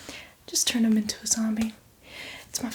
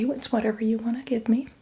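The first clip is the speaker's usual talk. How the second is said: ordinary talk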